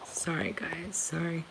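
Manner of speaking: barely whispered, overcome apology; choked up. Real, not acted. Vlogger apologizes to viewers for getting emotional, choked up. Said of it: "Sorry, I'm gonna get emotional".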